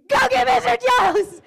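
high, loud shriek for backup; retold scream. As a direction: re-enact a teacher screaming for help. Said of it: "And I screamed".

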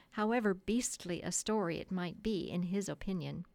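ordinary delivery

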